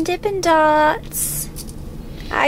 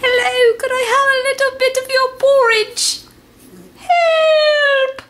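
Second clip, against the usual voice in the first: high whiny cartoon cry; squeaky cat pleading for help; Hello Kitty voice; 7 semitones higher than usual. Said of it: "Going to interview Hello Kitty".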